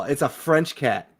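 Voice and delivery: ordinary talk, nothing marked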